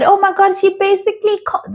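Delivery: high, soft, quick imitation; feminine impression of her. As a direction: mimic her dainty way of speaking. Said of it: "this is how she speaks".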